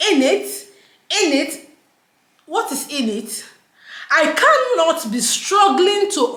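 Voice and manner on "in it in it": drawn-out, higher mock-British tag question; imitating a put-on British accent. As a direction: mimic an affected British accent, slowly. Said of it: "Accent has changed".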